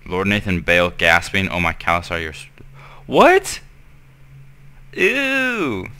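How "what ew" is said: high, sharp disgusted yelp; shocked. Real, not acted. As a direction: recoil in disgust. Shocked at porno.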